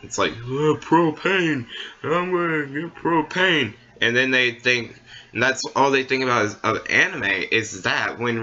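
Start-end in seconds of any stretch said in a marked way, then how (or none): 0.3-3.7 slow, higher drawling Texan propane salesman; King of the Hill impression. Poking fun at King of the Hill fans, imitating the propane dad. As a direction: lightly play the propane-loving cartoon dad. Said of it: "Oh, King of the Hill".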